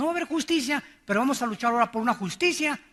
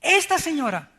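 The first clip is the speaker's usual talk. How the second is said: loud call to listen; 5 semitones higher, 7 dB louder than usual. Real, not acted. Preacher demands attention loudly.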